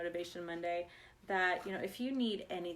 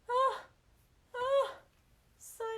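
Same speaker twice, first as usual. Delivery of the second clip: high, loud, tearful "oh, son"; crying out in mock tears; tearful aww; 6 dB louder, slower than usual. Mom overcome by her son's sweet apology, tearful.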